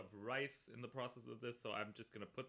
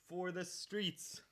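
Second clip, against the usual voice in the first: even slower, stretched out; slower dramatic; 5 semitones higher, much slower than usual. Pretend. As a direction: drag it out, higher and very slow. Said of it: "repeated rallying line".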